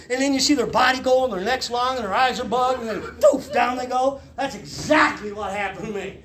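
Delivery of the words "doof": high, sudden "doof"; cartoon drop noise. Cartoon body dropping off the cliff, high doof. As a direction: punch out a cartoon falling sound.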